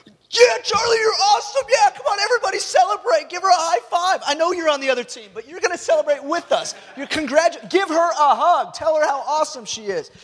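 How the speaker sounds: ecstatic cheering. Retold or imitated. Ref dad cheering his daughter's goal, excited shouting. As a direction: yell with pride across the soccer field. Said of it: "And I'm just like".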